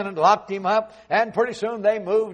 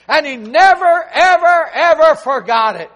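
ever, ever stretched and hammered loud; emphatic never ever; 8 semitones higher, 11 dB louder, slower than usual. Acted. Preacher hammering never, ever, ever to the congregation, loud. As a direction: pound each ever harder.

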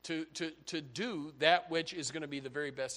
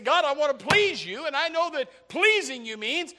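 loud, raised-pitch pleading with God; frustrated; 7 semitones higher, 8 dB louder than usual. Acted. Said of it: "he's frustrated 'cause he said".

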